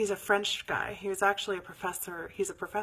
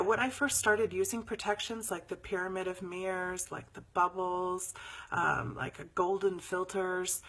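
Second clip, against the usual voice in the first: ordinary talk, nothing marked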